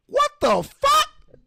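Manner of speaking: loud, high outraged yell. Real, not acted. Host reacting to daughters attacking their mom, yelling. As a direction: yell it in exasperated disbelief. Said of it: "I know I'm yelling".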